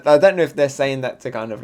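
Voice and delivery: usual speaking voice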